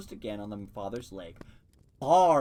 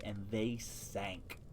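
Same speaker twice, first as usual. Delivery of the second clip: slow, sinking, softer delivery; 5 semitones lower, 10 dB quieter, much slower than usual. A narrator's reading. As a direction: let the words sink slowly. Narrator slows on sinking.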